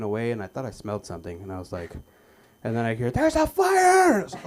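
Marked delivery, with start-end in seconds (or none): 3.1-4.2 high, slow, loud panicked cry; mock alarm yell. Shopper alerting the mall to a fire, panicked scream. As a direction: shout it like the store is burning.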